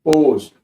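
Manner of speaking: পোস্ট with a stretched O; teacher's loud demo of long-O পোস্ট; exaggerated long O. Shown as a demonstration. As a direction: lengthen the O in পোস্ট for demonstration. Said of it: "এই স্টাইলের জন্য".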